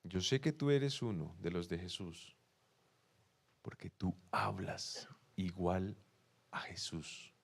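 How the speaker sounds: cita lenta, suave y grave; revelación en voz baja. Retold or imitated. Revela el sentido de la acusación. (en voz baja y pausada)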